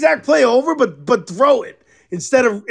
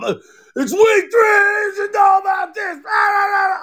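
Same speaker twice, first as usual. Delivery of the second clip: squealing fast mock rant, much higher and yelled; whiny referee mockery; 10 semitones higher than usual. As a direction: parody the ref like a shrieking whiner.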